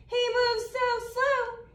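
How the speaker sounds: stretched slow. A narrator's reading. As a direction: drag "so slow" out like molasses.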